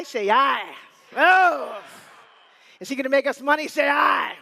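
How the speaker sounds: loud, punchy calls for an "aye"; rally the room behind him; crowd rally shout. Real, not acted.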